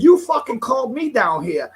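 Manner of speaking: angry comeback in a street argument; retold angry retort. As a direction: throw it back at her, heated. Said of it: "I said".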